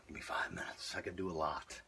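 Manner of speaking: breathless whisper, no voice left; running out of air. Real, not acted. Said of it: "just let me catch my breath".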